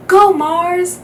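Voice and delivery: high, loud cheer from the crowd; calling out. A narrator's reading. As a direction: yell it like a fan at a street race. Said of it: "someone else called".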